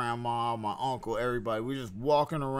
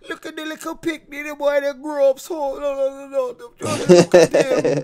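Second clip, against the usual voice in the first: high-pitched imitation of Jamaican relatives; Jamaican accent; 12 semitones higher, 12 dB louder than usual. Recalling relatives in Jamaica greeting him, high Jamaican accent. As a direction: Jamaican aunties fussing, voiced high and loud. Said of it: "everybody had that fucking accent".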